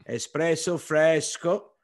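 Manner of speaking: slow, loud Italian flourish; Italian accent. Retold or imitated. Owner offers espresso to the next Italian guest, Italian accent. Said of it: "starts speaking Italian to me".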